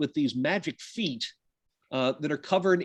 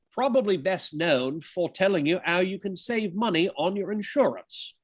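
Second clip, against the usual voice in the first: a lightly put-on English accent, higher; imitating the Geico gecko's English accent, badly; 5 semitones higher than usual. To his class, a self-mocking imitation of the insurance ad gecko in a hint of English accent. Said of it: "I'm sorry about the crap accent".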